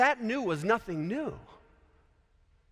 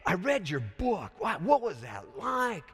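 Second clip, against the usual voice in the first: eager fan; 6 semitones higher than usual. Pretend. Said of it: "Somebody comes up to him and says".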